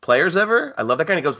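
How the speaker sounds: usual speaking voice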